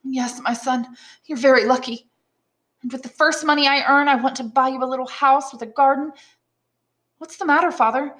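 high, eager character voices; voiced characters. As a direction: read the reunion dialogue with lively character voices. Dialogue continues raised.

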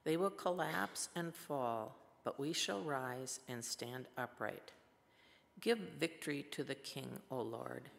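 normal talking voice

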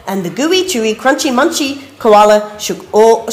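ordinary delivery

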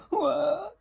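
high, loud pretend sobs and wah; hammed-up little beaver bawling; mock crying wail. A narrator's reading. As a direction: sob in bursts, then let out a big wah. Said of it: "said Little Beaver".

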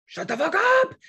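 high, loud hammed-up snap; mock yell. Retelling snapping at husband over morning coffee, hammed-up yell. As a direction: shriek it up high, playing exasperated. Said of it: "I'm just like".

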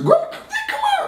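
very high, laughing call to grandma; squeaky kid call. Retold or imitated. Little kid calls grandma in a very high laughing voice.

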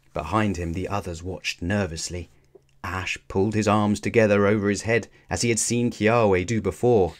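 ordinary delivery

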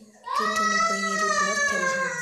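high, loud squeal; squealing shout. Real, not acted. Girl squealing to viewers from the hotel bathroom.

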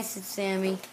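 plain reading voice

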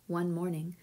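ordinary delivery, nothing marked

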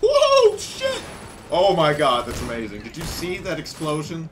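very high, loud exclamations; wild excitement. Real, not acted. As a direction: blown away by the explosion. Explosion excitement.

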